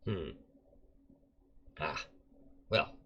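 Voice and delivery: lightly voiced old man, quiet and resigned; soft resigned old prisoner introducing himself; old Rowan.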